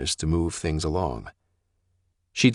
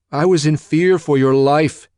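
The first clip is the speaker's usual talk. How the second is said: loud, high desperate plea; crying out; 11 semitones higher, 9 dB louder than usual. Acted. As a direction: cry it out to defend himself. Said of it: "he cried out".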